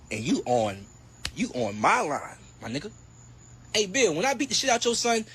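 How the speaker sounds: ordinary talk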